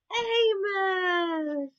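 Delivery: high, crying call of the dog's name; tearful call. Acted. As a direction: call him like you fear he's gone. Owners calling, close to crying.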